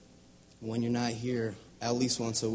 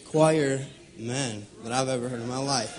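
ordinary delivery

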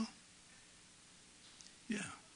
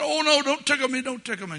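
put-on squealing kid; 14 semitones higher, 8 dB louder, faster than usual. Kids squealing being tickled.

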